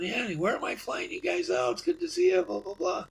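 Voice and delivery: high, breezy imitation of a friendly pilot; chatty pilot greeting.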